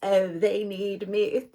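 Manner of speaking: slow, smug hint of a needy diva; self-important needy. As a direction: lightly play someone who thinks they're needed. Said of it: "I couldn't even pretend to, like, be that character".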